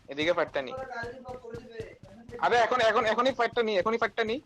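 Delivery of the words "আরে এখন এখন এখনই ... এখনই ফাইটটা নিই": higher, louder push to fight now; rally the squad urgently; urgent calling. Real, not acted. Urging team to fight now.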